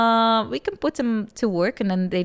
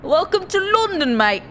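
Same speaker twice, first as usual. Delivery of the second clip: cheeky fake British accent, higher and louder; bad British accent; 9 semitones higher, 5 dB louder than usual. Streamer greeting viewers to London in a fake British accent. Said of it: "worst fake British accent in the world".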